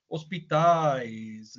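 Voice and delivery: ordinary delivery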